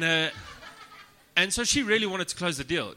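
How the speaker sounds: ordinary talk, nothing marked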